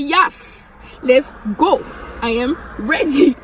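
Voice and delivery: drawn-out, emphatic imitation of eager agreement; hyped-up mimicry, each phrase punched; excited acted quote. Retells cousin eager for night out.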